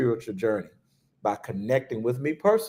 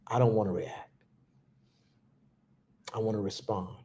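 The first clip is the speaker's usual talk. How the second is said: softer, lower and inward; hushed, self-steadying resolve; calm inner response; 4 semitones lower, 8 dB quieter than usual. Shown as a demonstration. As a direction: say it quietly to yourself, choosing calm. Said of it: "This is what I did in my sacred moment".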